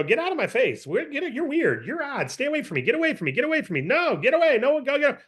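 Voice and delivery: high, brushing-off stranger, mock annoyed. Stranger at the escalator shooing off the pitchman, mock annoyed. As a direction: wave off a weird guy with a brick, mock irritated. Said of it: "And they're gonna go".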